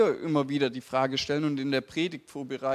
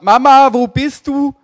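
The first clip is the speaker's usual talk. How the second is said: high, very loud call for mum; acting out a kid hollering for a parent; re-enacted childhood shout; 10 semitones higher, 14 dB louder than usual. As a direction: yell it like a child through the stairwell. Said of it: "Wie ich als Kind geschrien hab".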